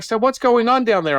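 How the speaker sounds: high-pitched nosy question; imitated mother calling. Mother asking the man at her foot, high inquiry. Mother asks again from above.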